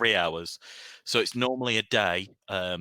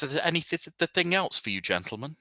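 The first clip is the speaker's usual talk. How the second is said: lightly stammered, higher question; stuttering merchant; 9 semitones higher, faster than usual. Acted. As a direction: trip over the thing. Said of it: "He has a very selective stutter".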